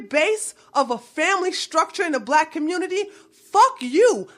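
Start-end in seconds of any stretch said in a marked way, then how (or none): 3.3-4.3 sharp, high angry burst; furious fuck you; angry outburst. Real, not acted. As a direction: spit it at them.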